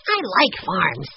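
put-on slow, emphatic child's declaration; positive. A narrator's reading. Boy declaring his love of farms, positive. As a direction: state it with full conviction, slowly. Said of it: "he said positively".

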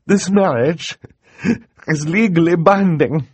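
high, loud, tearful verdict; acted distressed judge; through tears. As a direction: push the words out through tears. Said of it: "said through her tears".

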